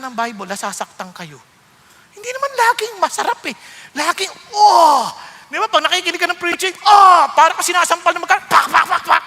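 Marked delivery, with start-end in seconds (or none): 4.5-5.1 loud, high startled cry; stung gasp. Retold or imitated. A listener stung by the preaching, loud sharp cry. Reacting as if slapped. 6.8-7.2 loud, high startled cry; stung gasp. Retold or imitated. A listener stung by the preaching, loud sharp cry. Reacting as if slapped.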